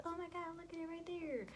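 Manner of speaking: high-pitched excited squeal; mock excitement. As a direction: imitate her gushing in a high voice. Retells an excited ultrasound reveal.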